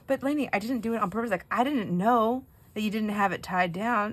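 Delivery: acted-out flustered self-defense; defensive protest, retold. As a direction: plead your case to an upset child. Defending herself in retold quarrel.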